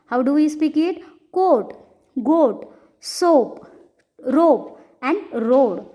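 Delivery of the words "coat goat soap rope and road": long held O in "Coat, goat, soap, rope and road"; long vowel demo. Shown as a demonstration. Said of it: "speak these words in long vowel sound". ("Coat, goat, soap, rope and road" में लंबा ओ)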